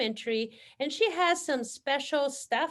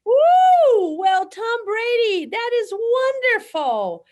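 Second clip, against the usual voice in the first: excited cheer; 9 semitones higher, 10 dB louder, slower than usual. Real, not acted. Cheering the guest's fan mail.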